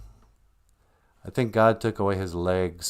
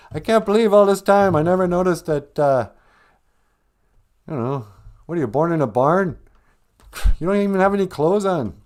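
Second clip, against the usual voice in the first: high, loud exasperated rant; mock outrage; 7 semitones higher, 4 dB louder than usual. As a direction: scold them like careless kids. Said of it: "I'm like".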